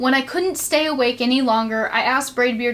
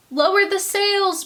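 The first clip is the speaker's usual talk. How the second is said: much higher storm order; pirate shout; 8 semitones higher than usual. Acted. Captain ordering crew in the storm, shouting. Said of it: "Braidbeard shouted".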